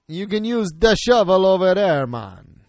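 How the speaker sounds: a playful imitation of the Jamaican accent, pitched a bit off his own voice. Player repeats the game character's line back, in a mock Jamaican accent. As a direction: echo his friend's Jamaican accent as a joke. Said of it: "He's got a Jamaican accent".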